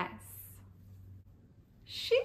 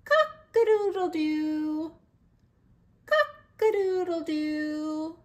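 rooster crow; 4 semitones higher, 5 dB louder than usual. Shown as a demonstration. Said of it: "Rooster says".

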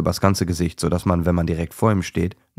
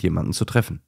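ordinary delivery, nothing marked

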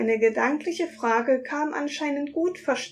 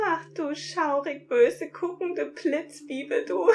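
laughing tease; 4 semitones higher than usual. Acted. Sprite teasing the glaring narrator, giggling. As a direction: coo it through giggles.

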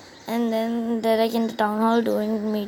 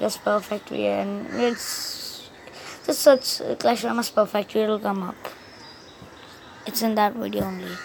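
usual speaking voice

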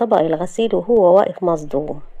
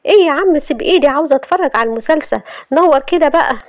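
high, indignant complaint; annoyed protest; 8 semitones higher, 3 dB louder than usual. Acted. Shahd to Youssef as he pulls her hand, indignant and high. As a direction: protest at being dragged off, pitched up.

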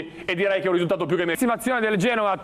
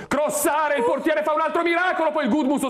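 excited play-by-play; 11 semitones higher than usual. Real, not acted. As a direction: lift the voice as the keeper saves. Live play-by-play rising.

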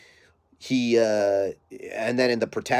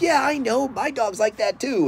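higher, mocking voice of a clueless owner; mocking dog owner; 13 semitones higher than usual. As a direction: parody the know-it-all owner in a high voice. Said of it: "a lot of people are like".

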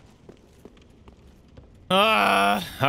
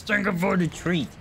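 dog voice; 5 semitones higher than usual. Acted. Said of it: "She says".

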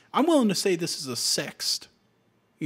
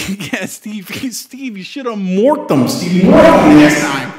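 laughing; 4 semitones higher, 14 dB louder, slower than usual. Real, not acted. Teasing teammate, sing-song, laughing.

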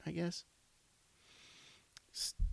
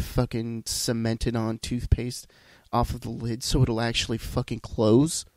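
louder and louder, real irritation building; getting mad; 6 dB louder than usual. Real, not acted. Venting to viewers about the wife's toothpaste cap, rising real anger. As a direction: rant hotter with each line. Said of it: "See, I'm getting mad".

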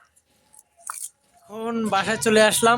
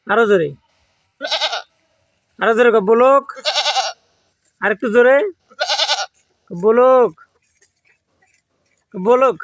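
loud coaching call; 3 semitones higher, 4 dB louder than usual. Real, not acted. Vlogger loudly drilling his goat to say blog, loud call. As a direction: coax a goat to say the word, loud.

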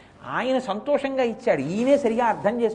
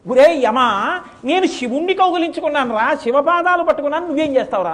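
higher, louder challenge; defiant taunt; 6 semitones higher, 6 dB louder than usual. Acted. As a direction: dare Yama to try. Boy taunts Yama. (యముడిని సవాలు చేస్తూ గట్టిగా)

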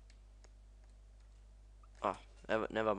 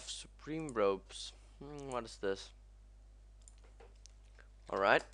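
usual speaking voice

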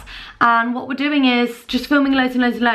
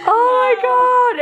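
delighted disbelief; 11 semitones higher, 5 dB louder, much slower than usual. Real, not acted. Laughing at a drawing.

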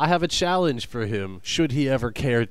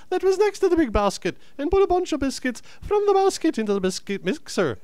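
very high, sing-song fake Irish; badly faked high-pitched Irish recitation; bad Irish accent; 13 semitones higher than usual. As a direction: recite the tongue twister in a squeaky mock-Irish lilt. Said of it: "Your Irish accent is completely a misrepresentation".

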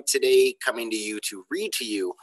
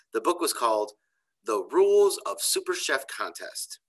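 put-on lively, expressive story reading for kids; reading to children; 4 semitones higher, slower than usual. A narrator's reading. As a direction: read it like a storyteller to a class. Teacher reads chapter to kids.

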